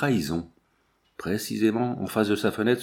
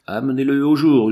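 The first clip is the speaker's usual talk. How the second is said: loud, raised-pitch command; yelled order; 4 semitones higher, 7 dB louder than usual. A narrator's reading. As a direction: shout it out to your men. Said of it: "hurla-t-il". (ordre hurlé)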